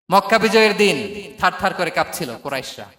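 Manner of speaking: ordinary delivery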